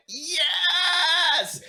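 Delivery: high, loud shout of joy; triumphant yes. Retold or imitated. Fan hearing Eddie owns his poster, shouting yes. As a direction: cheer like you just won. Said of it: "And I went".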